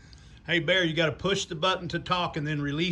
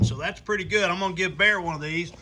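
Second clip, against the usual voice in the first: ordinary talk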